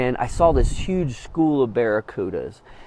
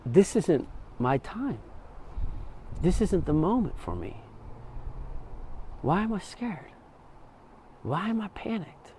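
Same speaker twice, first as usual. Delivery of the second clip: hushed inner calm; 5 semitones higher, 9 dB quieter than usual. Retold or imitated. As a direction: ask yourself gently, barely voiced. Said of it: "It's like".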